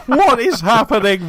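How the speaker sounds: high, laughing "what is happening"; bewildered and amused; laughing surprise. Retold or imitated.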